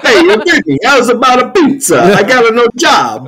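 put-on high, loud Italian accent. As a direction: play up a thick Italian accent. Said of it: "you can still do the Italian accent".

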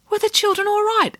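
put-on high, quick child voice; anxious little girl asking fast. A narrator's reading. Child character asks anxiously.

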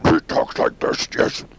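harsh throat-scraping alien growl; voiceless monster rasp; Venom. Shown as a demonstration. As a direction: rasp it until it nearly makes you cough. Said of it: "it made me cough".

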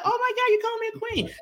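woman gushes on being called queen, giddy and high; giddy and happy. Retold or imitated. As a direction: gush like a flattered friend. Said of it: "they get giddy, they be happy".

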